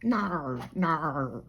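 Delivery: Australian "no" as a long naur; exaggerated Australian no. Shown as a demonstration. Showing how we talk funny, exaggerated Australian "no". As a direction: stretch "no" into a broad Aussie naur. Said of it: "the way we say no".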